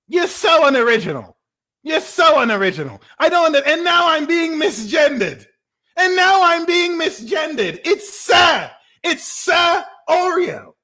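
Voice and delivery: very high, hammed-up indignation; theatrical huffy correction in a high voice; mock offended. Mock offence at being misgendered.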